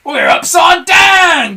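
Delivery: high, loud, slow alarmed shout; Mr Twit shouting. A narrator's reading. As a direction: cry it out in shock. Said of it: "gasped Mister Twit".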